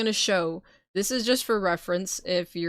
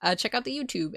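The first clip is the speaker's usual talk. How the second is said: ordinary delivery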